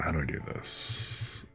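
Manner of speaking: normal talking voice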